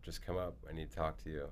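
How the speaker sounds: soft, grave imitation of a father; quietly insist, as a troubled dad; hushed and serious; upset dad. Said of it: "in this tone".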